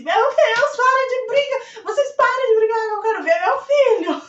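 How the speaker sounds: high, loud pleading yell; desperate shout. Retold or imitated. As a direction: yell to stop the fight, begging to see your son. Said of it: "eu gritei assim". (grito alto e desesperado)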